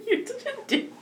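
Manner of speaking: high, squeaky laugh through the words; laughing too hard to speak properly. Real, not acted. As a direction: protest while cracking up. Cracking up while teasing back.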